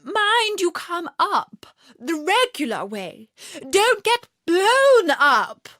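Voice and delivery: high, slow, loud scolding. A narrator's reading. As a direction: warn him slowly and shrilly loud. Queen warns the King.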